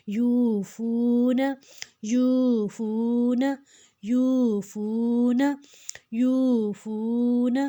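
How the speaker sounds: slow یوفون, "yoo" and "foo" drawn out evenly; long vowels. Shown as a demonstration. Teacher drills learners on stretching, evenly lengthened vowels in یوفون. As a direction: hold both oo vowels in یوفون, short final "na". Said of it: "لمبا کھینچیں گے".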